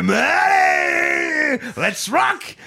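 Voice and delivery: high, slow, shouted hype; hammed-up rock cry. Host mocks Russell Crowe's priest hyping up, shouted. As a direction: belt it out like a priest going to rock. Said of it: "il fait".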